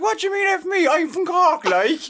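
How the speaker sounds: high, loud put-on Cork accent. Mascot answering the Cork heckler, loud Cork accent. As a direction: throw it back in a broad Cork accent. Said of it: "I went back in the Cork accent".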